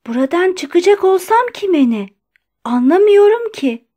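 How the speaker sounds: high-pitched, agitated protest; trembling agitation. A narrator's reading. Doctor blocked at the door by the guard, trembling agitation. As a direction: protest with rising distress in the voice. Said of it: "Sesi titremeye başlamıştı".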